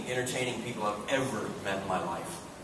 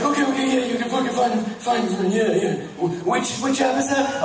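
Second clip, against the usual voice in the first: high, loud frantic babble; mock panic; 12 semitones higher, 6 dB louder than usual. Kidnapped friend searching for the app, imitated panic.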